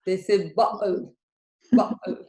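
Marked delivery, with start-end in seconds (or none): 0.5-1.1 Cockney bottle with a glottal stop for the T. Shown as a demonstration. Teacher showing what they say in Cockney English, T dropped. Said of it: "in the Cockney English". 1.7-2.2 Cockney glottal stop in place of the T; louder Cockney bottle, T cut out. Shown as a demonstration. Teacher repeating the Cockney English example, glottal T. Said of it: "in the Cockney English".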